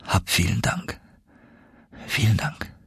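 soft, halting gratitude; tearfully moved. A narrator's reading. Nephew thanks uncle for last water, tearfully moved. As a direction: thank him quietly, close to tears. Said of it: "Tränen quollen aus meinen Augen".

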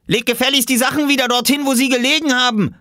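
fast, high, loud demand; outraged yell. A narrator's reading. Surfacing boy spotting his clothes taken, outraged fast yell. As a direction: yell the order in one rush. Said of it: "schrie er auf".

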